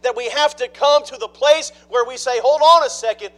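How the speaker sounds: loud, high, driving sermon delivery; a preacher building to full force; full-force preaching. Acted. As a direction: preach it out to the back pews.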